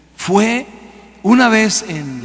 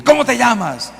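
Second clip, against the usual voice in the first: higher-pitched retold command to a demon; 7 semitones higher than usual. Preacher retells questioning a possessed woman, commanding. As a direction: confront the spirit, pitch jumping up. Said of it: "le hablé al demonio y le dije".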